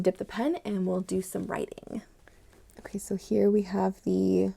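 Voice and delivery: hushed, breathless whisper over the new pen; soft unvoiced whisper. Real, not acted. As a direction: whisper it like a secret treasure.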